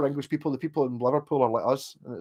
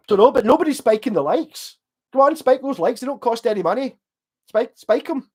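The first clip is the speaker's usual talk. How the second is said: urging viewers on; 9 semitones higher, 5 dB louder than usual. Real, not acted. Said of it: "urging viewers to like".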